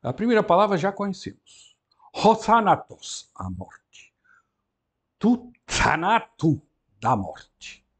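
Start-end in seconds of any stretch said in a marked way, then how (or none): 2.1-3.3 ho THANatos said slowly and loud; slow model word. Shown as a demonstration. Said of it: "vamos prestar atenção aos acentos". 5.2-6.6 tu thaNAto said slowly, stress moved; slow model word. Shown as a demonstration. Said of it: "vamos prestar atenção aos acentos".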